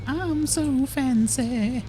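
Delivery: high, slow, drawn-out posh voice; posh fancy voice. Pretend. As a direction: stretch it out like a snooty aristocrat. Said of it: "my I'm so fancy button".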